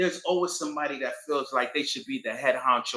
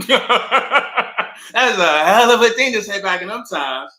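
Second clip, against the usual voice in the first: slow, louder, laughing through it; amused laughing repeat; 6 semitones higher, 10 dB louder, slower than usual. Real, not acted.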